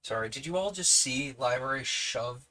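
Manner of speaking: ordinary delivery, nothing marked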